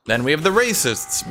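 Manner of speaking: ordinary talk, nothing marked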